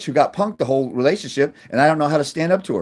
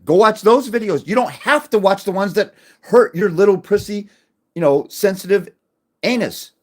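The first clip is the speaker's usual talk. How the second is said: mocking rant; 8 semitones higher, 3 dB louder than usual. Real, not acted. Mocking thin-skinned viewers.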